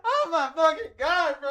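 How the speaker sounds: high, loud frustrated yelling; frustrated screaming. Real, not acted. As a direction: scream it out in despair. Stream breaks, screaming in frustration.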